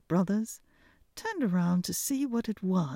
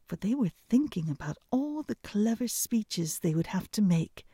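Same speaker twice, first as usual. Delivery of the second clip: ordinary delivery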